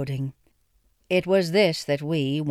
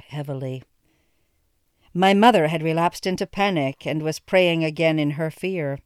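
plain reading voice

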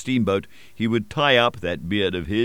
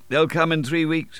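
said confidently; 8 semitones higher than usual. A narrator's reading. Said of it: "I said confidently".

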